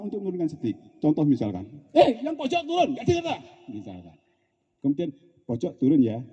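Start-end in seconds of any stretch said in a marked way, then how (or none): 1.9-3.4 high, scolding command; intonasi kasar. Acted. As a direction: scold the one at the end, pitched far up. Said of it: "intonasi suaranya nggak pas". (bentakan bernada tinggi)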